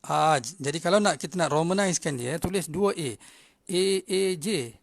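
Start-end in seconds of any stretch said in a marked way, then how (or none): 0.0-0.4 "Aj" loud, stretched as "aaj"; long alif. Shown as a demonstration. Teacher showing alif must be long, loud stretched "Aj". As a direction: hold the AA in "Aj" firmly. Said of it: "alif kena panjang".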